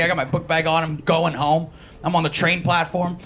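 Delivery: usual speaking voice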